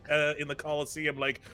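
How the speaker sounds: normal talking voice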